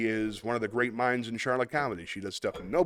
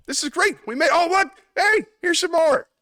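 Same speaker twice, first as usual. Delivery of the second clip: much higher, louder delighted outburst; excited; 8 dB louder than usual. Real, not acted. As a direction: burst out with glee. Spinning the comment wheel.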